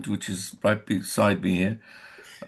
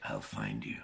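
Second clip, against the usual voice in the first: low, quiet, threatening whisper; menacing whisper; 11 semitones lower, 17 dB quieter than usual. Acted. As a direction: whisper it like a deadly promise.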